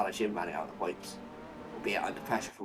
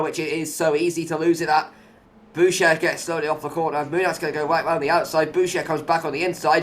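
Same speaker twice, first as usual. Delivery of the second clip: higher, louder live race call; excited commentary; 5 semitones higher, 7 dB louder than usual. Real, not acted. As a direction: call the battle with rising excitement. Live overtaking battle calls.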